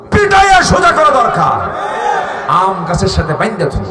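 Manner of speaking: high, very loud outburst; angry shout. Real, not acted. Preacher calling for punishment before the crowd, angry shout. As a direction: roar it out in outrage. Preacher demands they be beaten straight.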